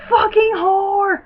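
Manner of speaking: loud, high, drawn-out yell; full-voiced holler at the TV; yelled insult. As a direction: yell it out, stretching each word. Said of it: "I yelled".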